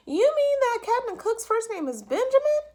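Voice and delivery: high, loud official voice; a fussy clerk on the line; phone clerk voice. A narrator's reading. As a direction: clerk quizzing Mr Popper by phone. Clerk mishears.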